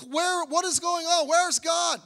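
high-pitched bewildered demand; exasperated questioning. Retold or imitated. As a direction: act out people crying for answers. Voices people distressed by repeated violence.

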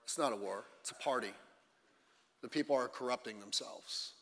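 low, quiet Moses, calmly correcting Joshua; a hushed, steady correction. Retold or imitated. Moses answering Joshua about the noise, quiet and low. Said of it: "Moses is like".

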